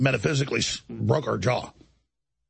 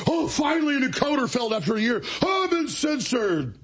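whiny mock victim; 10 semitones higher than usual. Mocking the host as a victim.

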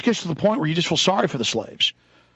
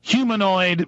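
ordinary talk

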